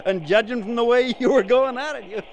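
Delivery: chuckles break into the words as he teases; laughing while teasing. Acted. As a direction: laugh through the line, enjoying the gossip. Sly joke about the kissing couple.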